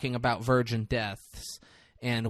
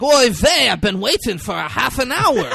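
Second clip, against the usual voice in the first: put-on high, loud, over-the-top Yiddish accent; 9 semitones higher, 7 dB louder than usual. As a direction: ham up a Yiddish shopkeeper accent. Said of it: "This is what he talked like".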